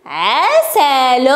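very slow, syllable by syllable; slow sounding-out. Shown as a demonstration. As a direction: stretch the word so each sound stands out. Teacher stretches word for sound spotting.

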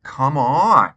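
frustrated outburst; exasperated groan of words. Acted. Frustrated at tape.

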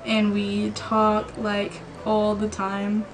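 slowly reading out words while typing; slow dictation. Real, not acted. Girl slowly spelling out her text to her crush. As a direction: drag each word as you type it.

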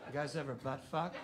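low, quiet, fast and creepy; serial killer voice. Shown as a demonstration. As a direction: ask it like a slasher killer. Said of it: "you sound like a goddamn serial killer".

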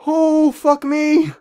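very high, slow, strained exclaim; stunned cry. Real, not acted. Gamer sees the giant player and cries out in dismay. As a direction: let it crack up high in disbelief.

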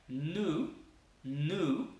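New with a drawn-out n; long N. Shown as a demonstration. Teacher drilling the long N. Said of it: "la N larga".

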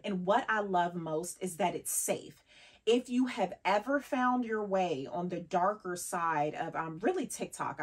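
ordinary talk

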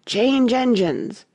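slow, high hoarse call; a hoarse passenger's slow announcement. Acted. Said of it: "but a hoarse voice spoke next".

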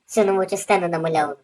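ordinary delivery

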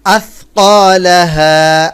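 আথকালাহা with the tongue tip at the teeth; careful tajweed আথকালাহা; Arabic th sound. Shown as a demonstration. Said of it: "জিহ্বার আগা থেকে".

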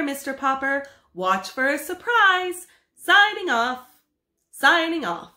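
high, loud broadcast voice; radio admiral. Acted. Admiral speaks to Mr Popper by radio, announcer. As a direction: announce it across the airwaves.

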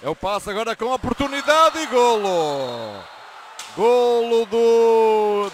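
very long, loud goal yell; explosive goal commentary. Real, not acted. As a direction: hold the goal note out.

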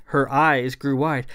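ordinary delivery

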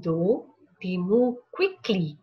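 plain reading voice

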